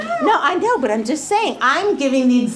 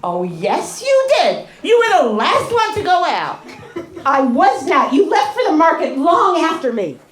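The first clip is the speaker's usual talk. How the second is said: louder, higher heated quarrel; angry bickering; 7 semitones higher, 4 dB louder than usual. Shown as a demonstration. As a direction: fight back, raising the voice. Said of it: "That was the bad example".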